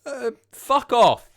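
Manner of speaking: loud, high, drawn-out protest; mock outrage. Real, not acted. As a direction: tell them off slowly, voice pitched right up. Laughing protest at chat.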